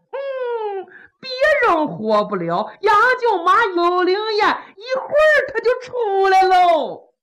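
high hum into singsong assurance; theatrical high-pitched confidence; eccentric old official. Acted. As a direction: insist teasingly, swooping upward. Kou Zhun insists she will rise.

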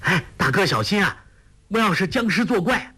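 urgent warning climbing in pitch; crying a warning. A narrator's reading. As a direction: call out to warn a friend of danger. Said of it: "杨康叫道".

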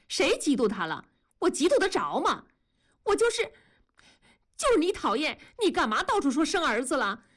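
high, cross wife in a quarrel; indignant denial. Acted. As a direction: protest hotly, pitch climbing into blame. Wife angrily denies jealousy, blames husband. (气恼地高声辩驳)

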